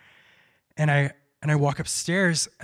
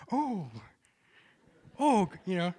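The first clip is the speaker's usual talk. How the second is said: nervous "oh, oh", then bright mock "I'm so happy"; forced cheerfulness; 3 semitones higher, 6 dB louder than usual. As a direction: cover anxiety with a cheerful front. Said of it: "I really was so genuine".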